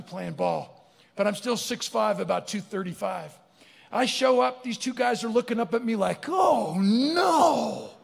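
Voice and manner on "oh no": high, drawn-out wail of dismay; mock dread. Two seatmates seeing a big man arrive, mock dread. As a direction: groan it out high in comic horror.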